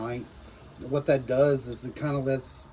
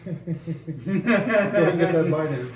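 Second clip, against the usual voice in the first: laughing; 4 semitones higher, 6 dB louder, much slower than usual. Real, not acted. Host laughs at friend eating.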